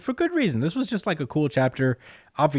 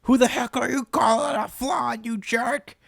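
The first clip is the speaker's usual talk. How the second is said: high, strained mock shout; imitated yelling; 13 semitones higher than usual. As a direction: act out an outraged anime hero's yell. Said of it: "he yells at the top of his lungs".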